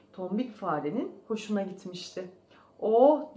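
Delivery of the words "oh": loud, relieved "oh"; sigh of relief. A narrator's reading. Mouse breathing in the clean forest air, relieved. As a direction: let out a big refreshed oh. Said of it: "diyerek derin bir nefes alıp".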